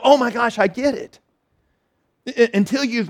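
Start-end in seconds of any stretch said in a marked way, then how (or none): 0.0-1.2 sudden realization. Retold or imitated. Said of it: "the lights go on and we go".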